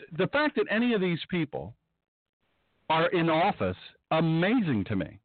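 normal talking voice

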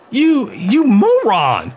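Emmeline lashing out at reckless Meade, furious; spat insult. Acted. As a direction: stammer then spit the insult. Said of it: "she spat out at him".

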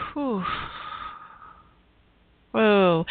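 sharp released breath. Real, not acted. Releasing heavy energy.

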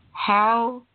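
ordinary delivery

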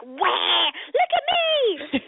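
high, drawn-out mock wail; whining kids. Mom imitating her kids clamoring for attention, high drawn-out whine. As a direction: wail it like an attention-seeking child, stretched out. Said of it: "They're all just like".

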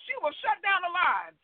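ordinary delivery

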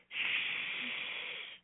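soft breathy shush; shushing whisper. Acted. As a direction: hush them urgently. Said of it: "he whispered".

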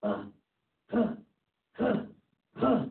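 short huffed lion grunts, spaced apart; gruffing lion. Retold or imitated. Storyteller imitates the lion at the zoo, gruffing. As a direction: imitate a lion with low-key throaty huffs. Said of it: "he was just, like, gruffing".

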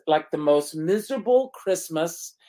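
ordinary talk

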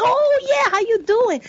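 cheery greeting. Retold or imitated. Actor's cheery greeting retold.

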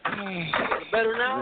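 slow, muffled, like through water; muffled underwater phone. Real, not acted. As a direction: talk as if on a bad phone line underwater. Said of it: "I thought you was talking underwater".

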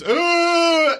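high, loud mock wail; imitated child wail. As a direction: wail like a crying child over the shushing. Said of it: "While he's".

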